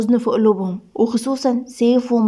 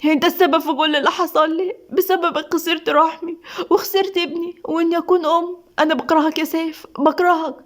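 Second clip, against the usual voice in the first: higher-pitched, pained accusation; tearful pain; 5 semitones higher than usual. Acted. Wife blaming husband for her lost baby, tearful and pained. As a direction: blame him through tears. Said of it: "زينة بدموع وألم".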